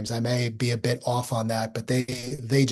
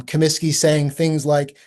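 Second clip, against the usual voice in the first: ordinary talk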